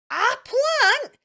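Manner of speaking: hammed-up horrified mum yell. A narrator's reading. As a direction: shriek it in disbelief. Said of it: "yelled Mum in horror".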